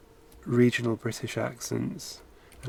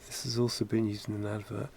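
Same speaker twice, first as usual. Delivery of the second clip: usual speaking voice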